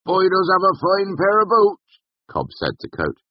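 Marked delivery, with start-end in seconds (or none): 0.1-2.0 high, loud rustic villager; approving tavern regular. A narrator's reading. Cobb to the innkeeper about boots, approving. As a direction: weigh in from the bar.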